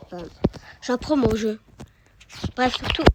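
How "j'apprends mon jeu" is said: ordinary talk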